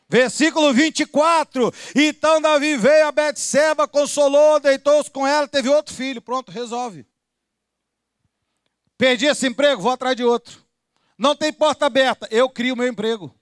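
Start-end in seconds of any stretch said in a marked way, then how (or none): 0.1-6.2 high, loud declaimed verse; ringing, high-pitched preacher's reading; full-force scripture reading. Real, not acted. 9.0-13.3 loud, raised pulpit declaration; emphatic, high-pitched sermon delivery; forceful preaching. Real, not acted.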